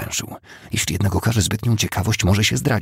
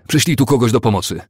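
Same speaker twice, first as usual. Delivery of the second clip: higher, louder urgent call for help; 6 semitones higher, 6 dB louder than usual. A narrator's reading. Shout for help across the room. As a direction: Ori calls to his cousin while holding fainting Madeleine, urgent shout. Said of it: "zawołał do kuzyna".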